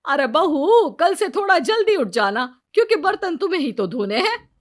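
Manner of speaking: high and loud, quickening as she sets a trap; sly mother-in-law lecturing the bride; scheming mother-in-law. Acted.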